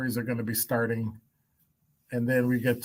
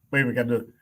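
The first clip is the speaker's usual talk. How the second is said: normal talking voice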